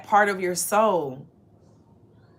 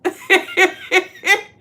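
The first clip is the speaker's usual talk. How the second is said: bright burst of laughter, much higher; 11 semitones higher, 9 dB louder than usual. Real, not acted. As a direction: laugh at your own joke. Laughing again at sheep joke.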